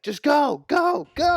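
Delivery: high, urgent acted shout; urgent mock yell. Dunk sending frightened villagers away, mock urgent yell. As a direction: shoo them off, voice climbing high.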